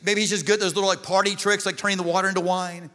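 forceful, projected pulpit voice; full-force preaching. Real, not acted. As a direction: preach it hard to the whole room.

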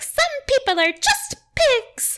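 very high, prim little squeak of a voice; hammed-up prissy falsetto; high-pitched prissy. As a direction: imitate a super prissy girl, way up high. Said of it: "she has this high-pitched voice and she's super prissy".

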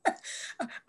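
breathy little laugh between words. Real, not acted. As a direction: let a quick laugh slip out. Laughing while recalling office.

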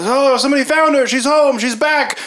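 high, excited cries; excited townsfolk. Acted. Townspeople think Saren is home, excited cries. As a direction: call the news from door to door.

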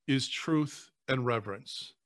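usual speaking voice

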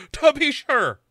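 high, loud stage Irish; hammed-up Irish accent. Host topping co-host's joke about Sarah, hammed-up Irish accent. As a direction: push the mock Irish up high. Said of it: "watching you react to the accent".

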